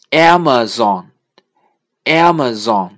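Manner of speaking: Amazon with the first A stressed, said slowly. Shown as a demonstration. Teacher stressing the first A in Amazon. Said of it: "它的重音在前面的A".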